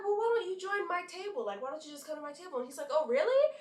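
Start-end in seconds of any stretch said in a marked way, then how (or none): 0.0-2.6 higher-pitched casual invite; acted friendly invitation. Re-enacting her invitation to stranger. 2.9-3.5 higher, louder surprised question; acted surprise. Acting his surprise at invitation.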